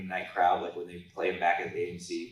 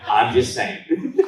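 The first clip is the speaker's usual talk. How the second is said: laughing; 3 semitones higher, 8 dB louder, much slower than usual. Real, not acted. Laughing after a teasing joke.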